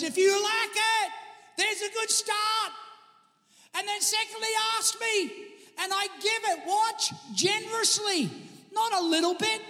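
high-pitched preacher's exclamation; lifted, high preaching voice with pauses; exclaimed scripture. Acted. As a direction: voice God's answer high, in emphatic bursts. Preacher exclaims God's answer to congregation.

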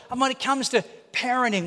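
usual speaking voice

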